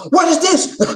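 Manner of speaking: high, loud mock outrage; hammed-up indignant demand; imitating scolding wife. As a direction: mimic a wife appalled by a mess. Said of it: "she'll walk in".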